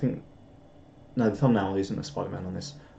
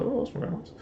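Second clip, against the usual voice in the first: high, soft bird voice; reply as a small bird, high and hushed; pretend bird reply; 12 semitones higher, 7 dB quieter, faster than usual. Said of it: "and the bird's like".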